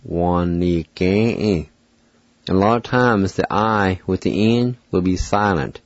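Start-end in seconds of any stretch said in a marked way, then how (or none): 0.0-1.7 Wa-ni-ge-e drawn out slowly, every syllable clear; slow careful pronunciation. Shown as a demonstration. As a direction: stretch Wa-ni-ge-e for the learner. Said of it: "pronounce these words".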